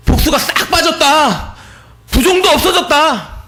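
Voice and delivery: mother's good news cried out for joy; joyful proclamation. Retold or imitated. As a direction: announce the healing with triumph. Mother's joyful news, testimony peak.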